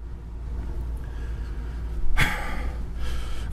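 soft, drawn-out exhale; long weary sigh. Real, not acted. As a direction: let out a long exasperated breath. Exasperated pause mid-rant.

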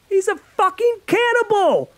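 high, loud enraged shout; girl yelling at the boy who set this up; furious yell. Acted. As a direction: hurl it at him. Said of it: "Paige yelled".

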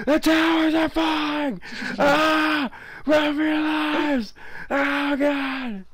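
high, slow panicked yell; hammed-up disaster-movie screaming; yelling while running away. Towers collapse in game, panic.

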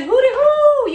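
high, loud sing-song hooty hoo; Tabitha Brown greeting. Retold or imitated. Host calls out to the universe, high loud sing-song. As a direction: call it out like Tabitha's trademark hello. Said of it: "I said".

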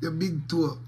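normal talking voice

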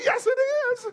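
high teasing comeback; playful retort. Acted. Pastor teasingly contradicts a complaining congregant, high and playful. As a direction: snap back high and cheeky.

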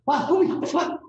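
high, loud amazed cry; excited exclaim. Retold or imitated. Pierre marvels at Louis's brushwork, high and loud. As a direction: gush over the work in a high voice.

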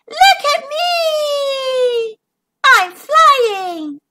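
very slow, high call out; triumphant ladybug; joyful triumph. Acted. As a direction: shout it with delight.

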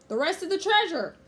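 high, loud pirate roar. A narrator's reading. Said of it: "roared Captain Bones".

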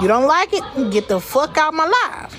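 ordinary talk

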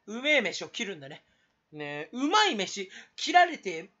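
ordinary talk, nothing marked

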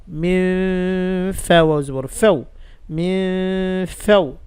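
slow drawn-out ghunna on ফেউ; nasalized ghunna. Shown as a demonstration. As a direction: hold the nasal on মিম ফেউ. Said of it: "ফায়ের দিকে গুণনাটা যাবে কেমন?". (ধীরে গুণনা করে পড়া)

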